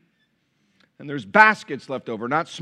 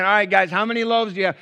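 mock sarcasm; 6 semitones higher, 5 dB louder, faster than usual. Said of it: "here we go again".

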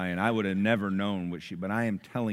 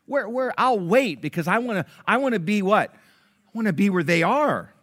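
eager customer; 9 semitones higher, 7 dB louder than usual. Retold or imitated. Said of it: "you're like".

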